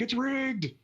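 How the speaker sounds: high-pitched imitation of griping losers; mock complaint. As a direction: whine "rigged" in a put-on high voice. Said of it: "everybody's gonna be like".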